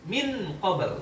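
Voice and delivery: "min qabl" with the N clearly sounded before the Q; idhar reading. Shown as a demonstration. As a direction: keep a distinct N in "min qabl". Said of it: "Kalau dibaca idhar ini gimana bacanya". ("min qabl" dibaca idhar, nun jelas)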